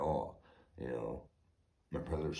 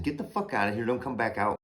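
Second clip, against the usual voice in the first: higher, louder shouted order; retold order to leave; 8 semitones higher, 6 dB louder, faster than usual. Homeowner retelling how he ordered the deputy out, loud and higher. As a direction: throw him off your land.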